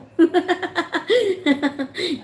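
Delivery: high burst of laughter; real giggle. Real, not acted. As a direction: laugh at the teasing. Laughs at César's reply.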